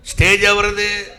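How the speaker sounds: loud, slow, laughing challenge; demanding whose stage it is, loud and slow. Retold or imitated. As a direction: hammer the question with a laugh.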